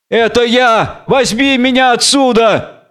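high, urgent plea for rescue; calling out. Acted. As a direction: cry out to be taken away. Samson calls up from the pit.

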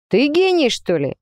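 half-teasing, wry question; amused scoff. A narrator's reading. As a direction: lightly scoff as you question. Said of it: "хмыкнул он".

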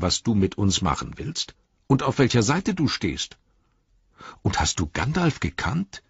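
high, fast, breathless hobbit questions; eager hobbit. Acted. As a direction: tumble the questions out quickly and brightly. Hobbit Merry voiced by narrator.

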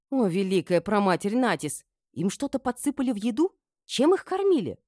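high, loud alarmed questioning; shocked exclamation. A narrator's reading. Mistress asks what they were fed, shocked. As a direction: exclaim in rising disbelief.

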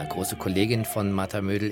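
normal talking voice

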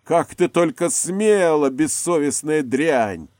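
loud, slow, furious roar; a betrayed husband roaring through the wall; thundering rage. A narrator's reading. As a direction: thunder it at her. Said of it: "гремел голос Снежаева".

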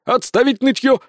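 high, loud barked command; exclaiming order. Acted. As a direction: snap the order out. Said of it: "воскликнул Сисюля". (громкий окрик)

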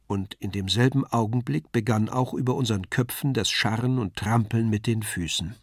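plain reading voice

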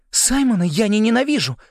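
high, loud protest; blurted out. A narrator's reading. Порша protests to Ригель, blurting it out. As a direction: let it burst out, pitched high. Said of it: "вырвалось у неё".

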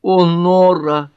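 high, loud, drawn-out exclamation; exclaimed. A narrator's reading. Pat reacting to her sister's engagement, exclaiming. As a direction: cry it out slowly, pitched well up. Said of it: "воскликнула Пэт".

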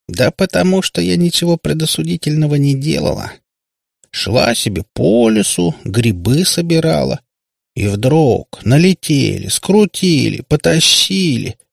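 high, drawn-out innocent plaint; притворная невинность. A narrator's reading. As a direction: play the wronged innocent, stretching the words. Kutiyana plays the innocent victim. (с наигранной жалобностью, нараспев)

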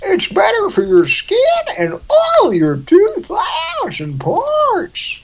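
high, slow shouted commercial. Pretend.

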